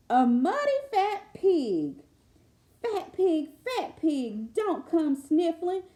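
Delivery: lilting read-aloud refrain; sing-song lullaby reading. Acted. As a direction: chant the refrain like a lullaby rhyme.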